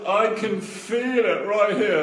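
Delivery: high, loud, stretched-out protest; excited insistence. Retold or imitated. Little boy holding on to the desk, imitated excited insistence.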